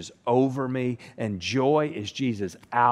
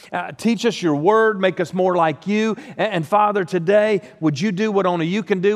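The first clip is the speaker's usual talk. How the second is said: raised, fervent prayer voice; pastor's prayer; 5 semitones higher, 4 dB louder than usual. Acted. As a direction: pray aloud over the congregation. Leads congregation in prayer.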